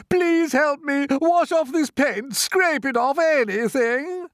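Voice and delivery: high, loud, slow pleading; bawling plea. A narrator's reading. Centipede begging to have paint scraped off, bawling plea. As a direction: bawl desperately for help. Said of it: "bawled the centipede".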